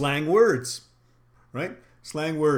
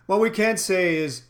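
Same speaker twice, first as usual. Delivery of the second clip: normal talking voice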